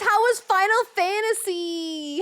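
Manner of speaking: high squeaky hype greeting voice; cute anime streamer. Acted. Streamer greeting chat, high excited.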